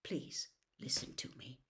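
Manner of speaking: soft whispered plea; gentle unvoiced whisper; quiet whisper. A narrator's reading. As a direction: hush it, almost breathless. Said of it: "said Dumbledore quietly".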